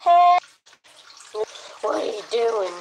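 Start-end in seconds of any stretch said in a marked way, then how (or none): 0.0-0.4 loud, high call; sudden shout. Real, not acted. Streamer suddenly calling out mid-task, a high shout. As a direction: shout it out sharply.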